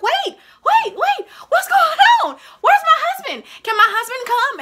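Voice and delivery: very high, loud panicked shout; panicked pleading. Retold or imitated. Patient wheeled off to surgery calls for husband, panicked. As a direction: cry out frantically to stop them.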